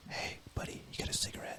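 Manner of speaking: very soft, secretive whisper; whispering in ear. Acted. Stranger asking a buddy for a cigarette, whispered. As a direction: whisper it right into someone's ear. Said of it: "is he, like, whispering in your ear".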